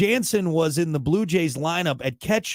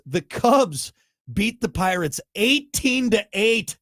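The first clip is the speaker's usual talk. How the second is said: slow, higher, every number weighed; dramatic score read; 6 semitones higher, 3 dB louder, slower than usual. Acted. Host to co-host, stretching a lopsided score for drama. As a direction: spell out the big score slowly for effect.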